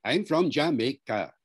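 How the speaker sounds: Jamaican English, every syllable punched evenly; Jamaican syllable-timed accent. Shown as a demonstration. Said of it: "you know, rat-tat-tat-tat".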